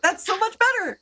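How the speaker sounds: very high, excited little creature voice; high-pitched, overjoyed fairy dragon; gleeful fairy dragon. Acted. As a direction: squeal with delight as a tiny dragon. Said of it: "the fairy dragon comes back to you guys and says".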